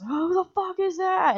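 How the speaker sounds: high, alarmed cry; startled fear. Retold or imitated. Friends by the car spot a flashlight, alarmed high cry. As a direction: jump at a light coming toward you.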